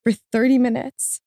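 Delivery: choked up, voice pitched high; fighting back real tears with a small apologetic laugh; getting emotional. Real, not acted. Guest apologizing for getting emotional mid-story, choked up. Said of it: "I'm getting so emotional".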